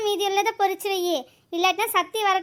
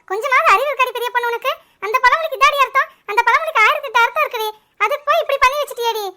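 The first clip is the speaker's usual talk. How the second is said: high, loud telling-off; angry scolding; 4 semitones higher, 5 dB louder than usual. Acted. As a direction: berate them in exasperation. Scolding foolish idea after accident. (கோபமாகத் திட்டும் குரல்)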